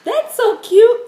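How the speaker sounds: very high, loud squeal of "cute"; little boy copying girly talk. Retold or imitated. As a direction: shriek it like a kid parroting the grown-ups. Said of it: "he would always say".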